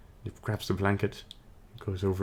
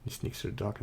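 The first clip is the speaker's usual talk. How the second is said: plain reading voice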